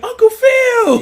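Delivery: high, loud mock greeting yell; excited shout. Will greeting Uncle Phil at the door, excited shout.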